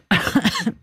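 sudden cough while choking; coughing as something goes down wrong. Real, not acted. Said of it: "je m'étouffe".

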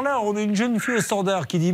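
ordinary talk, nothing marked

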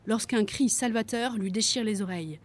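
plain reading voice

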